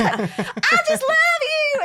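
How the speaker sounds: high, loud, drawn-out Southern gushing; hammed-up adoring Southern squeal; friend's Southern accent. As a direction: gush it slowly in a big Southern accent. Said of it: "She has the best accent".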